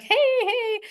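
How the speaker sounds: high-pitched, happy-go-lucky American hello; happy-go-lucky greeting. Retold or imitated. American trainer greeting everybody she meets, happy-go-lucky. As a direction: imitate a chirpy, carefree greeting. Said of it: "happy go, like".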